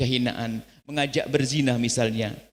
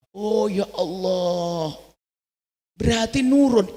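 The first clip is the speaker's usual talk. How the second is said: eager exclamation; 5 semitones higher, much slower than usual. Retold or imitated. Husband hearing gossip from his wife, eagerly exclaiming. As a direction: pounce on the juicy news. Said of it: "sambutannya luar biasa".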